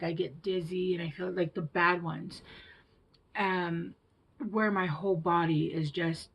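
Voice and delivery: normal talking voice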